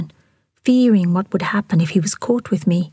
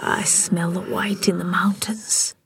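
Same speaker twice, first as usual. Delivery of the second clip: slow, soft, faraway voice; trance-like, slow and hushed; entranced; 6 dB quieter than usual. A narrator's reading. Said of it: "merciless in his phase state".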